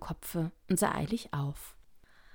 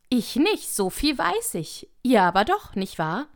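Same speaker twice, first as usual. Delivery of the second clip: high, loud protest passing the job on; emphatic refusal; 7 semitones higher, 7 dB louder than usual. Acted. Workers squabble over who climbs the chimney, protesting loudly. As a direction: refuse loudly and push it onto someone else.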